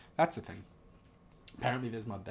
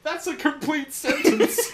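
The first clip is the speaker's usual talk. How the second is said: high, slow, laughing through it; can barely get it out for laughing; dissolving into laughter; 5 dB louder, slower than usual. Real, not acted. Laughing at absurd generated text.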